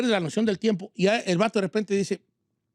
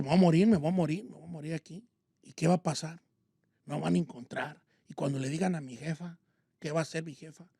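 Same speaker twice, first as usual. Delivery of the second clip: despair; 5 semitones lower, 6 dB quieter than usual. Retold or imitated. Despairing inner thoughts while suffocating.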